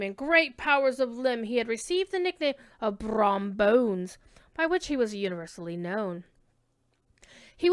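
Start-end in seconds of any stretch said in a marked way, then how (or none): none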